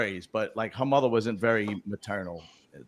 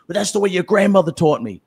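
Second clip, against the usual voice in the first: louder, higher indignant mom; mock angry mother; 5 semitones higher, 6 dB louder than usual. Mother defending her ways to her son, indignant. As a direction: snap back like an offended mother. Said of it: "she would be like".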